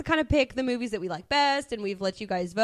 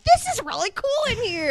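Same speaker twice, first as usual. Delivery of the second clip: retold amazement; 12 semitones higher than usual. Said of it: "I walked in and was like".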